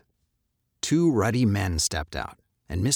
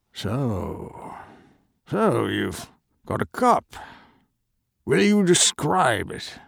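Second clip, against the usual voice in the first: slow, higher, cautious merchant; wary merchant; 5 semitones higher, slower than usual. Acted. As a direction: probe carefully. Merchant suddenly interested.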